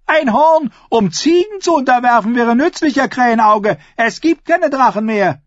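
high-pitched, derisive retort; mocking scorn. Ascher ridicules Euron's dragon horn, high mocking scorn.

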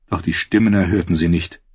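plain reading voice

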